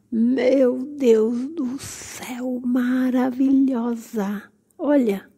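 hugely stretched, slow wonder; awestruck gushing. Real, not acted. Vlogger overwhelmed by a succulent's beauty, slow awed gush. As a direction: draw every word out in amazement.

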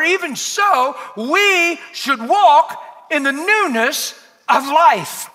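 high, loud, drawn-out scripture declamation; full-force preaching. Acted. Preacher challenging the church with Romans, full-force preaching. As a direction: belt out the verse slowly to the whole room.

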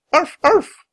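high, loud yappy barks of a small dog; dog barking. A narrator's reading. Little dog warns of the water, barking. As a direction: bark like a little puppy. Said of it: "barked Teddy from Jack's pack".

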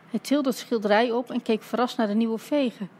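usual speaking voice

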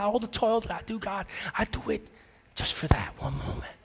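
a hushed, unvoiced whispered prayer. Pastor reliving prayer for his daughter, whispering. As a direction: pray in a near-breathless whisper.

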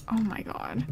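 breathless whisper of shock; whispered oh my God. Real, not acted. Streamer watching the dog die, stunned whisper. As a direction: barely voice it, stunned. Sad moment in game.

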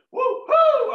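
high loud woo; excited whoop. Real, not acted. Whooping to the crowd in delight. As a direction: whoop with joy.

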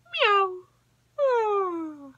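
high sleepy kitten meow. A narrator's reading. Striped kitten meows happily about bedtime. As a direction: meow then a little high oh. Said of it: "said baby striped cat".

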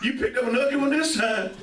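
lightly voiced taxi driver, pitched high; teasing mock cabbie hail. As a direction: call out high like a joking cabbie. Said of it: "And the taxi driver says".

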